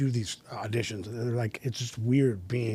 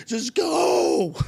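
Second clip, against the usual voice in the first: much higher and louder, begging; mock pleading; 6 dB louder than usual. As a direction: beg him to stop, voice jumping way up. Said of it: "He's like".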